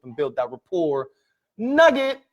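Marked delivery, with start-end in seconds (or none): 1.6-2.2 high, loud announcement; nugget callout. Acted. Presenter flagging a key lesson, high loud callout. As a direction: flag the lesson with a bang. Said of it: "teaching nugget callout".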